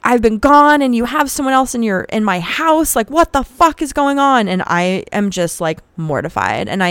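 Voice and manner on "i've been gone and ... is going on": high, loud, shrill-pitched furious rant; furious fiancee yelling. Retold or imitated. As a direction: scream it like a betrayed fiancee catching a cheater. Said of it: "pop off and go".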